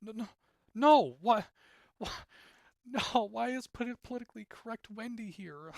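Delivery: high, loud disbelieving protest; a viewer's high outraged disbelief. Retold or imitated. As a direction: protest in shock at the screen. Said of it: "I was like".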